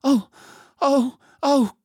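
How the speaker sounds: high, loud cries of dismay; crying out. A narrator's reading. Two girls find Aslan gone, crying out in dismay. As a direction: cry out high, each one separate. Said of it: "cried the two girls".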